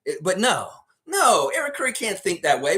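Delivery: usual speaking voice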